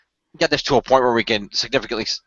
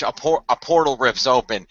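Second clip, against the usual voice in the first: usual speaking voice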